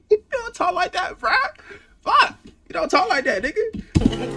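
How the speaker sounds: high-pitched disbelief; squeaky, amused protest; incredulous scolding. Real, not acted. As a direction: call out the fake talk, voice way up high. Mock scolding the singer, amused.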